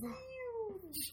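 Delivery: high, soft parody "huge"; small squeaky mock of a politician; Trump. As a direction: stretch the catchphrase up high, softly. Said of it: "it reads like Trump's saying it".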